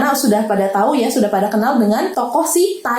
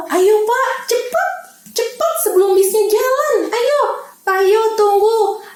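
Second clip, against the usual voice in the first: urgent; 9 semitones higher than usual. Acted. Urging running man, high voice.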